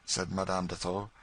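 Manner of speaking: ordinary delivery, nothing marked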